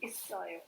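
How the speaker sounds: "isoyo" with an s sound, softly; correct pronunciation. Shown as a demonstration. Teacher confirming the word to students, soft s pronunciation. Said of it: "It should be".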